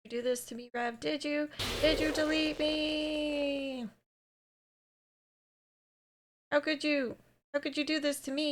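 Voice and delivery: put-on mild heartbroken game girl, softly pleading; hurt pleading Monica. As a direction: beg Rev not to delete you. Reads Monica's deletion lines aloud.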